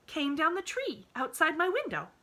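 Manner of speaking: put-on bright, high little girl voice; eager child reassuring her friend; cheerful little girl. A narrator's reading. Pollyanna voiced in story.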